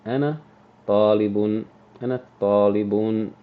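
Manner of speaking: teacher modeling a phrase to repeat; repeat-after-me model. Shown as a demonstration. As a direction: say it loud and clear so the class can copy. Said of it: "repeat after everything I say".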